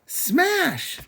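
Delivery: put-on very high squealing baby shout; toddler yell. Toddler Honey yelling.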